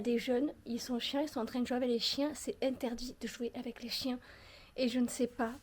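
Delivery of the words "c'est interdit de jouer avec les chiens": hushed aside so they won't hear; whisper. Real, not acted. To viewers about the neighbours, whispered. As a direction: breathe it out, no voice.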